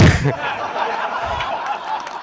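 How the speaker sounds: a quiet, airy burst of laughter; breathless laugh. Real, not acted. Speaker laughing at his own Cowboys joke, quiet airy laugh.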